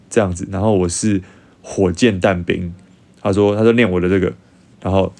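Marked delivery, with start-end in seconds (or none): none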